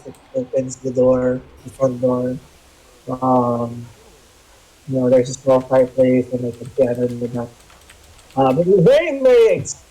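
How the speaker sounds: talking through a hand over the mouth; muffled, covered mouth. Real, not acted. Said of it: "Medyo muffled yung voice mo".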